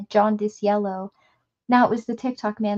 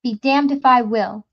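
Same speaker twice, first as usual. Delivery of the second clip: defiant angry; 2 semitones higher, 7 dB louder than usual. Acted. Gasher defiant.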